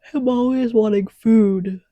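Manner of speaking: words stretched by a yawn. Real, not acted. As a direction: talk through a yawn. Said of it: "I don't know why I'm yawning".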